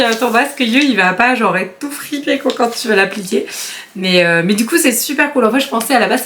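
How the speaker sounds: ordinary delivery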